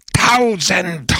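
high, drawn-out cry of a crowd; big high-pitched roar; howling crowd. A narrator's reading. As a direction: howl it out like an excited crowd. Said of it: "heulte die Menge".

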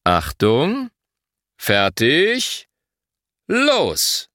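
"Achtung" and "fertig" drawn up in suspense, then "los" high; rising "Achtung", rising "fertig", pause, then a high falling "los"; start signal. A narrator's reading. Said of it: "gibt das Startzeichen".

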